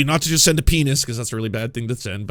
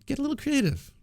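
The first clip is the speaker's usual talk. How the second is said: high, soft and teasing mock woman; lightly played flirt asking for more; coy woman; 11 semitones higher, 4 dB quieter than usual. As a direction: tease him in a higher, hushed voice. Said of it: "It's like".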